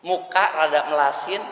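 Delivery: usual speaking voice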